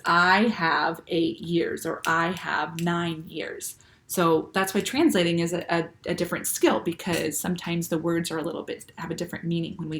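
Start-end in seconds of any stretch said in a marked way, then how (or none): 0.1-3.8 drawn out, each word weighed; slow literal version. Shown as a demonstration. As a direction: spell out the odd literal phrasing slowly. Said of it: "But it literally means".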